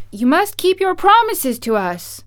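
high, loud insistent demand; exclaiming. A narrator's reading. Dorothy holds the wizard to his promises, exclaiming. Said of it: "exclaimed Dorothy".

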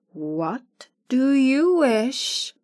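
lightly voiced dignified monkey king; a hint of a courtly bow. Monkey King greets Dorothy, dignified and slow.

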